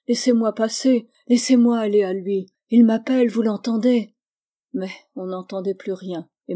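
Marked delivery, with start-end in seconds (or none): 0.1-4.1 loud frantic pleading; pleading outburst. Acted. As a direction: beg to be let through, almost shouting. Charlotte begs to be released.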